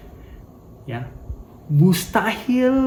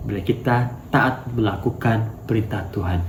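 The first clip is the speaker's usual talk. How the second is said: ordinary talk, nothing marked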